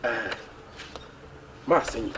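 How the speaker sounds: low, very soft instruction; hushed confiding. Acted. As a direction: murmur an errand privately. Quietly sending friend on errand.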